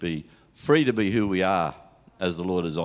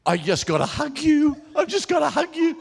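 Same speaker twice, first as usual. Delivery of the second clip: put-on much higher, eager old-lady plea; Sylvia's gushing plea. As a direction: beg for a hug in a high gushing voice. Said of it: "And then Sylvia said".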